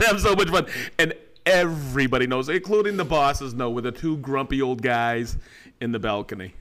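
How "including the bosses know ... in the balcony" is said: ordinary delivery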